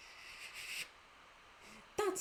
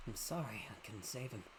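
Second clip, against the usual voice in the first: low, soft, sinister villain; 7 semitones lower, 12 dB quieter than usual. Acted. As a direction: taunt them quietly. Voicing villain in game.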